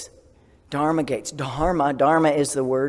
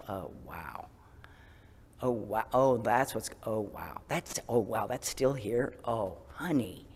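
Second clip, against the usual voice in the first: much softer, slow, gentle wonder; tender self-compassion; 5 semitones lower, 8 dB quieter, slower than usual. Retold or imitated. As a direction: speak to yourself like to a scared child. Tenderly addressing her own fear.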